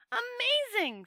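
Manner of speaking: high, loud cry of wonder; thrilled amazement; amazed. A narrator's reading. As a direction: marvel out loud. Two Flower marvels at worlds.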